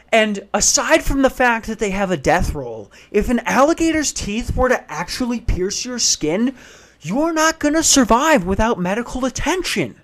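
high, insistent mock lecture; imitated arguing neighbour. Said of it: "I hear this fucking guy go".

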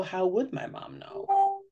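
put-on high-pitched voice of a wondering child. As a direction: lift the voice high and slow like a puzzled kid. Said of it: "it allows her to go".

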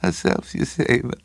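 high, stuttering, breathy voice; spluttering little man. A narrator's reading. Little man leaning to Craven, stuttering and spluttering. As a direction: splutter it out high, then settle. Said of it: "the little man turned and sprayed him".

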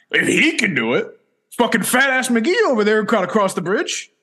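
high, scornful jeer; mock it up as an insulting outburst; rude tone. Imitating Charlie's insult about Hurley, high and scornful. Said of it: "it was the tone".

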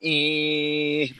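long drawn-out electric seat whirr; imitated seat motor. Slow car seat moving back, imitated motor whine. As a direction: hold a steady motor whine.

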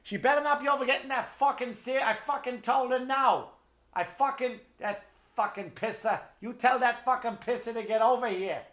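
squawky mock Boston mother; Boston mother yelling. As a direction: imitate an angry South Boston mom scolding.

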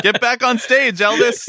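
high, cheering push; mock rallying call. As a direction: egg him back on stage. Mock pushing friend back out.

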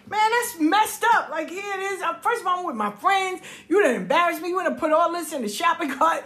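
high-pitched protest with stretched words; indignant complaint. Retold or imitated. Child to mother after embarrassment before friends, aggrieved protest. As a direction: act out an aggrieved kid's rant.

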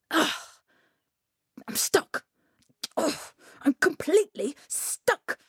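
groaned realisation, with pauses; exasperated groan. A narrator's reading. Coyote finding himself trapped, exasperated groaning. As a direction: let it sink in, groaning.

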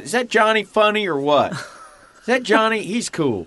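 high, eager dad voice; a hammed-up proud father; dad gushing about Johnny; imitating dad. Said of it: "and he goes".

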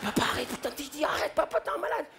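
panicked whisper-plea. Retold or imitated. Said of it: "Moi, je lui ai dit".

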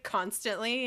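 usual speaking voice

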